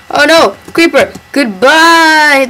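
playful pretend panic; voicing the player. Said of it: "And he'd be like".

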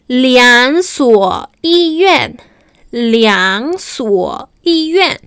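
"Liang suo yi yuan" read slowly, "yuan" falling; slow sentence demo. Shown as a demonstration. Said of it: "La segunda frase".

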